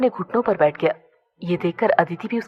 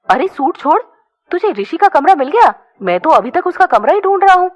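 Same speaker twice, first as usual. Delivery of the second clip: startled; 8 semitones higher, 5 dB louder than usual. A narrator's reading. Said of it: "Aditya भी चौंक कर बोला".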